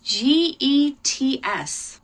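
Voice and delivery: slow letter-by-letter spelling; teacher spelling a new word for kids. Shown as a demonstration. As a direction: dictate each letter slowly. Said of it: "I'll spell that for you too".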